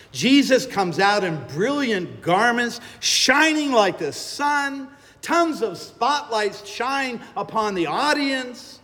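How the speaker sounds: rising excitement. A narrator's reading. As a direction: let the glory build in your voice. Climax of the resurrection scene.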